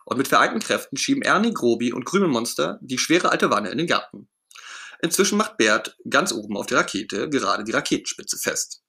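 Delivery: usual speaking voice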